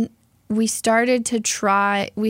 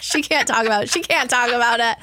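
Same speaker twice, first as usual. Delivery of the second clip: laughing; 6 semitones higher, 3 dB louder than usual. Real, not acted. Teasing while co-host laughs.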